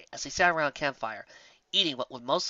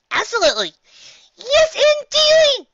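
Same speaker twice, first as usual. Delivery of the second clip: much higher, louder and slow squeal; gleeful squeak; 13 dB louder, slower than usual. Acted. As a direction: squeak with glee. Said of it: "Squeaky squeaked with glee".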